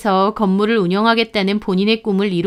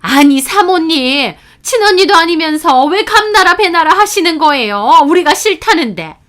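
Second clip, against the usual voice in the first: aggrieved protest; 7 semitones higher, 7 dB louder than usual. Acted. Mother protesting to the landlady, high aggrieved complaint. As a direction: complain as if wronged. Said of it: "억울하다는 듯 따지는 겁니다".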